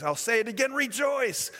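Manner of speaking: rising, emphatic call to rejoice; exhorting. Acted. Preacher exhorting rejoice loudly.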